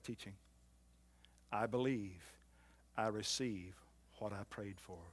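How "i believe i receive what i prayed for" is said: softer, trailing first take; soft confession. Shown as a demonstration. Preacher giving the confession first, softly. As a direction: say it quietly to yourself. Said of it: "your confession today ought to be this".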